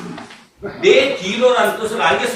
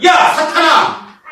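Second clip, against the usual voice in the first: shouted rebuke; 6 semitones higher, 6 dB louder, slower than usual. Acted. Jesus rebuking Satan.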